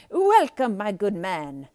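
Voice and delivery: lightly voiced cheerful candlestick; loud, bright welcome; candlestick greeting. A narrator's reading. Candlestick Lumiere welcoming Maurice, lightly voiced. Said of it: "said the candlestick".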